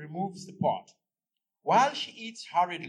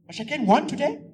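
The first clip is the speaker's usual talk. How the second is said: much higher and louder, eager; surprised question; 10 semitones higher, 10 dB louder, faster than usual. Acted. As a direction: jump on the news. Wife asks husband about match.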